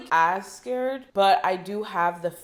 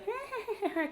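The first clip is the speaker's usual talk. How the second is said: high, eerie imitated clown laugh; weird clown laugh; 7 dB quieter than usual. Telling a friend about the scary movie clown, mock eerie laugh. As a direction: mimic the creepy laugh of a clown sign. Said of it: "kind of laugh".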